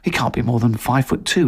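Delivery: ordinary delivery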